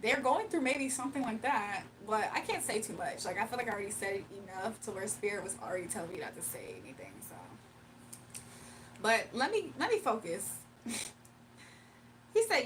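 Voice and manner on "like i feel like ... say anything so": fast, secretive whisper; real conspiratorial whisper. Real, not acted. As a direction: drop to a whisper as if someone might overhear. Hushing what Spirit says not to.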